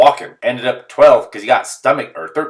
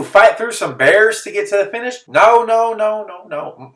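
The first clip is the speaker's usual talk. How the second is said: mock panicked refusal; 11 semitones higher, 5 dB louder than usual. Refusing to run further after leaders dropped out, frantic rising rant. Refusing to continue race, ranting.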